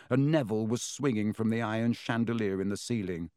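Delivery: plain reading voice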